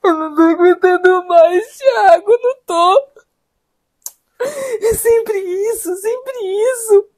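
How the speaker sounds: crying, anguished plea; tearful despair. Acted. Wife begging husband to defend her, crying in despair. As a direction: plead through tears, worn to breaking.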